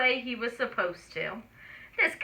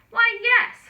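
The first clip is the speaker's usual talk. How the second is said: high, loud cheery mother pig; 11 semitones higher, 6 dB louder than usual. A narrator's reading. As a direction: answer brightly as mama pig.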